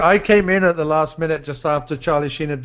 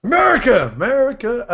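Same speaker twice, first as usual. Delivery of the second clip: mock patriotic cheer; 8 semitones higher than usual.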